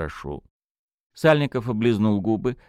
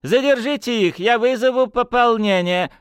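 shrill high-pitched bluster; high, loud, flustered official; panicky high voice; 4 dB louder than usual. Acted. Panicky colonel shouting orders.

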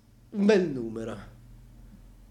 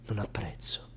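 whisper; 5 semitones lower, 7 dB quieter than usual. Acted. Solemn aside on freedom.

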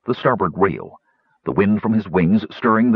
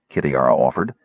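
plain reading voice

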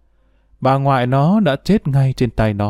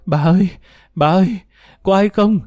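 high-pitched desperate cries, climbing higher; screaming for help; 8 semitones higher, faster than usual. A narrator's reading. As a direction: call out in mounting panic, without full volume. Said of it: "Nam gào thét".

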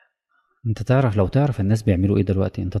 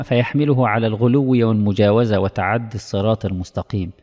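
usual speaking voice

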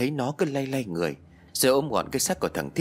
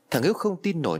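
ordinary delivery, nothing marked

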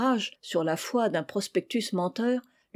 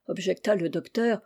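usual speaking voice

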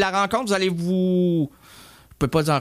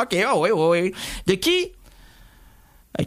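ordinary delivery

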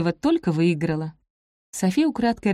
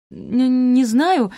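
higher, halting uncertainty; hesitant stammer; 6 semitones higher, 4 dB louder, much slower than usual. A narrator's reading. Софи is pressed about an earlier meeting, stammers uneasily. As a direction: stumble on the first sound, evasively.

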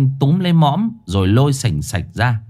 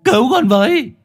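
wailing desperately; 9 semitones higher than usual. A narrator's reading. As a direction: call out to your parents in despair. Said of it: "y gào lên thống thiết".

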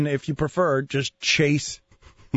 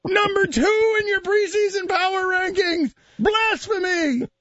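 barbarian; 12 semitones higher than usual. Retold or imitated. Continuing the enraged fan voice.

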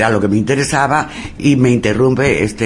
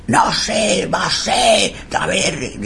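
high, anguished impression; high-pitched, lamenting cry; mock guilt-stricken wail; 7 semitones higher, 3 dB quieter, slower than usual. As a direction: imitate a soul tormented by guilt. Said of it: "un sentimiento de culpa terrible".

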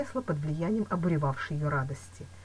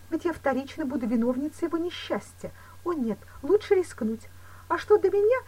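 higher-pitched, lightly voiced woman; agitated Louise; 11 semitones higher, 3 dB louder than usual. A narrator's reading. Woman ready to risk herself for lover, agitated. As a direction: plead with rising urgency.

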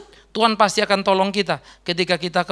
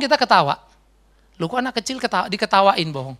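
ordinary talk, nothing marked

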